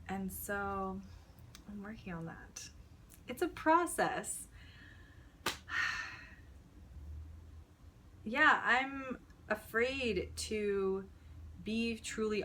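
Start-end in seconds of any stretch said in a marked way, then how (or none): 4.5-7.9 long, drawn-out exhale; heavy sigh. Real, not acted. Speaker about to admit being afraid, long heavy sigh. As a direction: breathe out slowly and audibly.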